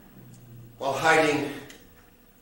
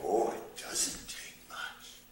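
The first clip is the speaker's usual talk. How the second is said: whisper; 8 semitones lower, 13 dB quieter, slower than usual. Acted. Hushed aside to listener.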